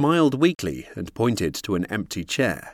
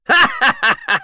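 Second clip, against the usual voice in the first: cruel laugh; 7 dB louder than usual. A narrator's reading. Villain laughs.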